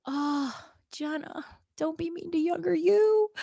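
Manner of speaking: high, cooing self-reproach; pained tender plea. Retold or imitated. Woman to herself on seeing a childhood photo, high tender plea. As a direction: plead in a high voice, as to a small child.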